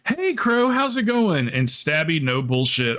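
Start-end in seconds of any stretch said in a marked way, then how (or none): 0.1-1.5 bright high mimic of a cheery streamer; high voiced greeting. Streamer greets Crow in a high mock voice. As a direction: squeak out a friendly hello. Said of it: "Hell's Light goes".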